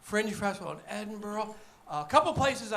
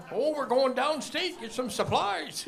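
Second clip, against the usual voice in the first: mock Yooper dad rounding up kids; hammed-up Yooper accent; 7 semitones higher than usual. Yooper family voiced.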